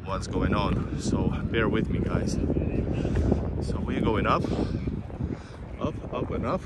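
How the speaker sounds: usual speaking voice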